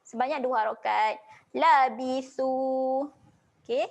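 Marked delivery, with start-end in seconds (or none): 1.5-3.1 "Labithu" with the tha held two beats; slow "Labithu", final vowel long; stretched ending. Shown as a demonstration. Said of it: "panjangkan saja huruf sa ni". ("Labithu" dipanjangkan dua harakat)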